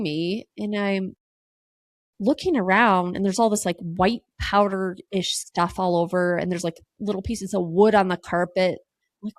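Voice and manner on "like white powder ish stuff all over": ordinary delivery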